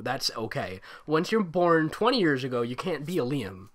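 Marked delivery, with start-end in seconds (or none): none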